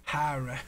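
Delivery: Horror said as hah-ruh; imitating her accent. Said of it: "It's like me and the word horror".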